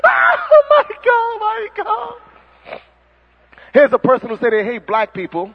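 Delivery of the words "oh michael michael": very high, crying scream of a name; hammed-up fan screams, fading; mock fan screaming. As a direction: shriek like a sobbing fan. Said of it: "people out there screaming".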